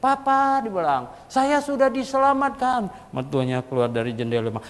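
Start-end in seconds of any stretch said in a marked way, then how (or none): 0.0-0.6 high, loud call up to the house; shouting. Retold or imitated. Convert calling his father-in-law at night, loud and high. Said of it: "Dia teriak-teriak". 1.3-2.9 high, loud call up to the house; shouting. Retold or imitated. Convert calling his father-in-law at night, loud and high. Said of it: "Dia teriak-teriak".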